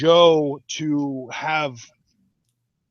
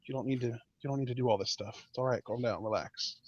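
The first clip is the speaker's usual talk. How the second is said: much softer, low and hushed; calm soothing; 5 semitones lower, 9 dB quieter than usual. Retold or imitated. Co-host imitates Nakamura calming Joe, soft and hushed. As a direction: soothe him down quietly. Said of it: "he's just like".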